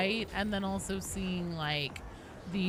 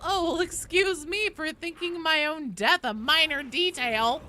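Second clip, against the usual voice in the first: higher, sarcastic mock outrage; mock indignation; 6 semitones higher, 4 dB louder than usual. Streamer voices the Psyker answering the Zealot, mock-indignant. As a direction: act out an affronted comeback with lightly hammed-up sarcasm.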